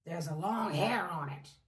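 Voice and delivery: low, loud bear growl; growling Daddy Bear. Acted. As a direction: growl like a cross papa bear. Daddy Bear continues, louder.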